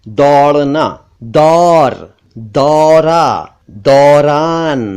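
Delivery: दौड़ना, दौर, दौरा, दौरान with open, held "au"; emphatic AU sound. Shown as a demonstration. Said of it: "मैं जिस तरह से बता रहा हूं".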